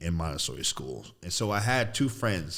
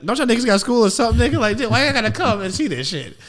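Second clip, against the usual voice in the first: put-on high, loud whiny kid voice; squeaky loud childish complaint; 14 semitones higher, 8 dB louder than usual. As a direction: complain like an annoyed little kid. Kid protesting brothers coming.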